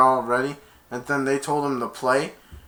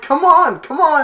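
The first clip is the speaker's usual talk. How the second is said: high, loud urging; cheering mom; 11 dB louder than usual. Retold or imitated. Mom urging her son from the sidelines, loud and high. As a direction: cheer him on. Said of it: "His mom's like".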